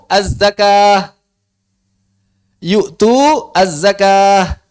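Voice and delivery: yu'tu az-zakah drawn out slowly, the vowels held; slow recitation. Shown as a demonstration. Teacher models the reading of yu'tu az-zakah, slowly. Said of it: "recites the example".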